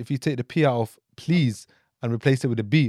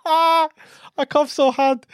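very high, drawn-out laughing wail; 10 semitones higher, 4 dB louder, slower than usual. Real, not acted. Friend telling of his coughing mishap, helplessly laughing. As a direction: squeal it out through real laughter.

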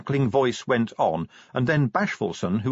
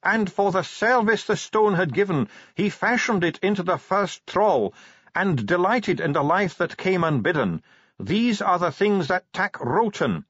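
high, projected scripture reading; shouted recitation; 8 semitones higher than usual. A narrator's reading. Dwarf recites over arguing listeners, shouting high. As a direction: keep proclaiming over the noise, pitch held high. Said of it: "He was shouting now".

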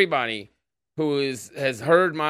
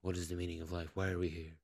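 hushed, much softer lofty musing; mock Lex Fridman; 7 semitones lower, 16 dB quieter, faster than usual. Podcaster mocking his guest-host's lofty questions, hushed mock musing. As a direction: murmur a grand question like a soft-spoken podcast host. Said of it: "And he'll always ask".

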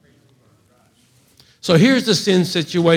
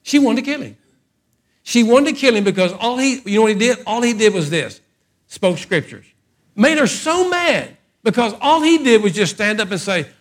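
fiery preacher climbing higher; preaching at full force; 6 semitones higher than usual. Acted.

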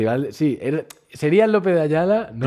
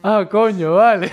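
louder, higher startled letdown; surprised; 7 semitones higher, 7 dB louder than usual. Retold or imitated. Crowd seeing the real Brad Pitt, surprised and let down. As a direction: react like a fan who expected someone else. Crowd realising their mistake.

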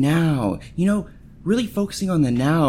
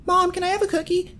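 put-on much higher, wheedling little-kid voice asking mom; pleading kid; 4 dB quieter than usual. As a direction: play a kid sweet-talking mom for a cookie. Kid begging mom for cookie.

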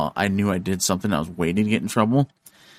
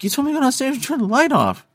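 put-on high innocent kid. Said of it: "I'm like".

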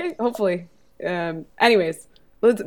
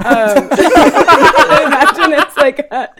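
usual speaking voice

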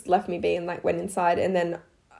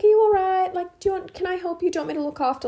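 high, gentle concerned lady; a kind nurse-like offer; kind stranger; 10 semitones higher, faster than usual. Retold or imitated. Helpful stranger offering mum help, high and gentle. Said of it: "she was just like".

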